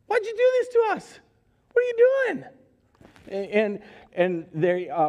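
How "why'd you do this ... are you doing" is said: much higher, fast, louder frantic scolding; a panicked mother demanding answers; frantic parent. Retold or imitated. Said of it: "and they say".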